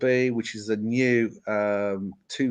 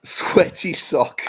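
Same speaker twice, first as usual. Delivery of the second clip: slow, breathy put-on Scottish voice; Scottish accent attempt; 6 semitones higher, 5 dB louder, much slower than usual. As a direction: drag it out like a bad Scots impression. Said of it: "give me your best".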